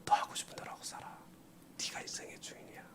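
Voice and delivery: fast breathy whisper, barely voiced; devil's whisper. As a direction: whisper the temptation quickly. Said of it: "마귀의 속삭임". (빠르게 속삭이는 마귀의 목소리)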